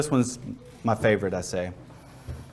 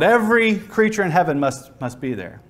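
normal talking voice

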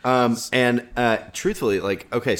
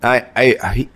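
ordinary talk

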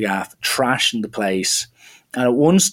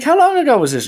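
re-enacted high, squeaked question; alarmed disbelief; 13 semitones higher, faster than usual. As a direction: pitch shoots up in mock shock. Teller acts out his alarmed question.

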